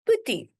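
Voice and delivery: "Boetie" high and loud, first syllable short; demonstrated pronunciation. Shown as a demonstration. Teacher repeats "Boetie" for learners, high and clear. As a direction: say "Boetie" as a high, brief model. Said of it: "Let me show you how to pronounce it".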